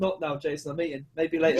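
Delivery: quiet, low mock refusal; imitated brush-off. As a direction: fob off a pest under your breath.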